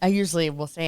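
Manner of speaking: normal talking voice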